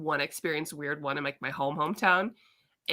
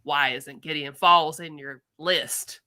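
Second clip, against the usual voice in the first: slow, low-pitched Southern drawl imitating a customer; mock Southern comic-fan voice, lower and slow; lightly put-on Southern accent for a pushy fan; 6 semitones lower, slower than usual. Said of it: "I don't know why it's Southern".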